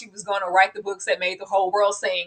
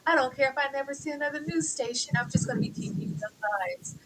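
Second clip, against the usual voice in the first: mocking quote; 4 semitones higher, 9 dB quieter than usual. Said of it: "if you're lying and saying, like".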